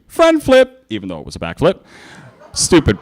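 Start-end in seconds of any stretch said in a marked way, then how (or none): 0.1-0.8 screamed. Acted.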